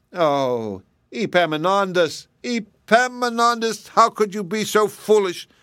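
building to a high, wailing scold; exasperated cry. A narrator's reading. Mammy to boy who dragged the bread home, high exasperated wail. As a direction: draw out the name, climbing in despair. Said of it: "cried his mammy".

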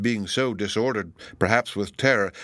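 usual speaking voice

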